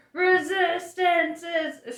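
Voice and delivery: drawn-out, high, loud bellow. A narrator's reading. Vogon guard showing off his shouting, bellowing. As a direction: fill the lungs and bellow it slowly. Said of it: "He filled his lungs and bellowed".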